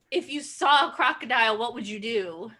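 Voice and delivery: normal talking voice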